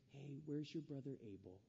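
gentle God voice. Acted. Voicing God's gentle question.

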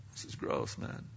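breathy whispered dead man's remark; whispered aside. Pretend. Dead body in the tomb whispering its disgust. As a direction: whisper it like a grossed-out corpse.

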